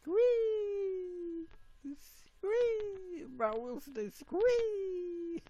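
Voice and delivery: very high hammed-up squee, slow at the end; mock fan squeal.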